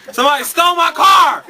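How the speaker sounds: sudden high loud outcry; shouting. Real, not acted. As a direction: yell it out in shock at the theft. Announcing his car was stolen.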